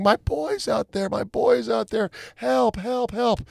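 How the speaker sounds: high, drawn-out mock wail; imitated screaming woman. Woman screaming for her boy at the beach, imitated wail. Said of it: "a woman that was screaming".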